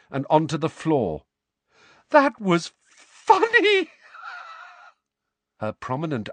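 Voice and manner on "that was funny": high, loud laughing shriek; shrieking with laughter. A narrator's reading. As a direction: squeal it through helpless laughter. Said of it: "She let out a scream of mirth".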